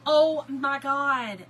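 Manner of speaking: loud, drawn-out exclamation; exasperated. Real, not acted. Telling viewers about the prank, loud exasperation. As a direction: wail it slowly and loudly.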